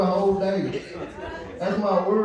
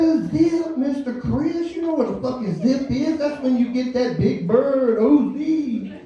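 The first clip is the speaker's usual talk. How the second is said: put-on higher cocky teen voice; cocky kid; 6 semitones higher than usual. Teen schooling his boss on drug weights, higher cocky. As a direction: play the know-it-all kid.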